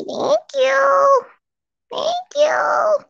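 high squeaky voice for the dog; dog's voice. Pretend. Owner voicing Molly thanking the chat in a high squeak. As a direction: squeak it like the puppy talking.

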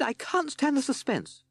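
high, drawn-out wail; panicking captain. A narrator's reading. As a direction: wail it slowly at a high pitch. Frightened captain cannot bear it.

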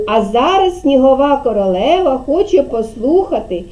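ordinary delivery

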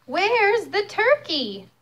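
high, slow questioning wife's voice; farmer's wife. Acted. Wife asking the farmer, high and slow. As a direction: ask it high and drawn out. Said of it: "asked Farmer Jake's wife".

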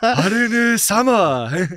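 put-on drawn-out, rising Japanese-accented greeting; mock Japanese accent. Friend plays the sushi chef greeting his guest, mock Japanese accent. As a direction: stretch it out slowly in a Japanese accent. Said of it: "Can you do the accent for me".

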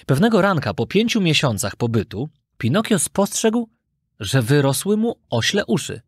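usual speaking voice

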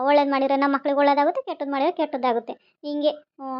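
ordinary talk

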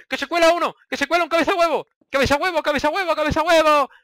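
high, loud alarm calls; alarmed shout. Real, not acted. Gamer yelling that an enemy is sneaking in, loud alarm. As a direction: yell the warning to the team.

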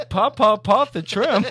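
high, loud mocking repeat; mock the stutter with a laugh. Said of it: "And he's like".

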